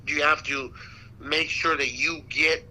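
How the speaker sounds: ordinary talk, nothing marked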